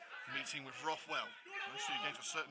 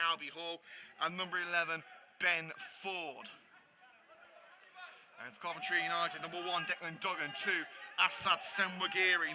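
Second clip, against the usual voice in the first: steady announcer reading names and numbers; lineup read; 3 semitones higher, 4 dB louder than usual. Acted. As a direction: call out each player like a stadium announcer. Reads the starting lineups.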